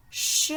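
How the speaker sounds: "ch" as a voiceless, sustained shush; isolated CH sound. Shown as a demonstration. Teacher revealing the repeated sound, "ch" as a long shush. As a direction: sound out "ch" alone, not as letter names. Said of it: "les lettres qui font le son ch".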